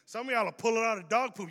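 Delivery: ordinary delivery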